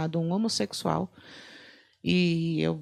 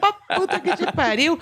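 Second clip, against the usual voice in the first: high, loud through laughter; repeating the curse laughing; 10 semitones higher, 4 dB louder than usual. Real, not acted. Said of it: "repeats curse laughing".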